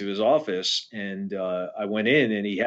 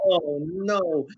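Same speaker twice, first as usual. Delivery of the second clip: mock dismay, higher; 7 semitones higher than usual. Dismayed after signing transfer papers.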